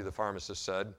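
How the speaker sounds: usual speaking voice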